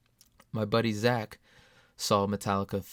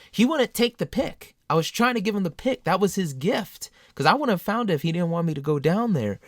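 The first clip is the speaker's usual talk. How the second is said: raised, higher and louder; emotional and loud; 8 semitones higher, 7 dB louder than usual. Real, not acted. Telling listeners about Zach refusing the pick, raised and emotional. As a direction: push it out, overcome.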